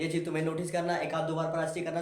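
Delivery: usual speaking voice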